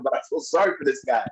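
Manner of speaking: normal talking voice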